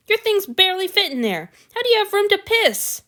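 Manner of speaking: high, loud incredulous rant; indignant. A narrator's reading. God berates the tiny closet, high and loud. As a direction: rail at how absurd it is.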